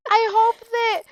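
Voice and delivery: mimicking a child vlogger; YouTuber voice. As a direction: address the viewers like a host. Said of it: "then she's like".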